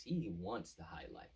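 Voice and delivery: ordinary delivery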